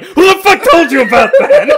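high, loud, laughing outburst; mock paranoid shout. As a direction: yell it at the nosy wrestler, cracking up. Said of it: "And you're like".

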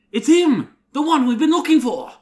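high, fast, thrilled; excited. Acted. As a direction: blurt it out in delight. Excited character discovery.

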